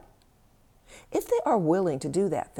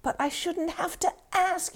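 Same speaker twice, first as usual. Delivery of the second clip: much higher, protesting rail; 13 semitones higher than usual. Acted. The tired partner rails at the inner voice, high and indignant. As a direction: hurl "I shouldn't have to ask" up high in outrage. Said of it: "you rail".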